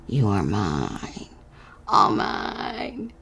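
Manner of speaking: slow, low sinister wizard voice; menacing enchanter. Acted. The enchanter claiming little Carney, slow and sinister. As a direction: claim her like an evil sorcerer.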